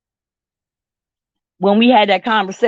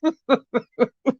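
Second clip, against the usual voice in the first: laugh after the bit; 4 semitones higher, 4 dB quieter than usual. Real, not acted.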